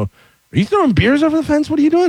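high-pitched retold scolding; accusing parent. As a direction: confront your kid in disbelief. Father confronting son in retold story.

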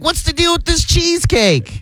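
high, nasal-free mock standup voice; hammed-up observational comedian bit. Joking about dessert set, mock comedian voice.

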